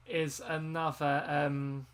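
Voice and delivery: ordinary delivery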